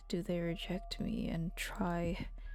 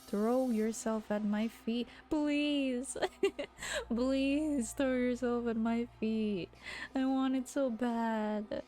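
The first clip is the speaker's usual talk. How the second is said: slow, drawn-out hammed-up plea; mock begging; 2 semitones higher, slower than usual. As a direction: beg desperately as a joke, dragging the words. Replays beast's line as mock begging.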